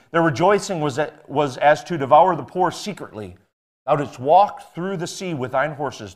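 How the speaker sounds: fervent King James reading; scripture reading. Acted. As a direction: proclaim the verses with mounting force. Reads scripture in preaching voice.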